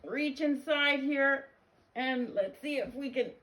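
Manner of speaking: high, loud vet voice, slowing; vet character. Acted. Vet about to reach inside George, high and loud. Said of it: "the vet said".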